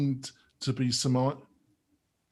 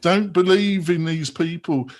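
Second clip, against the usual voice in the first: smug leader voice, louder and higher; mocking the leader; 3 semitones higher, 7 dB louder than usual. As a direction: puff up like a benevolent dictator, loud. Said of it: "he seems to be saying".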